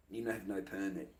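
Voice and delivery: dropped, muttered aside. Shown as a demonstration. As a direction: say it again under your breath. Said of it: "repeats softer".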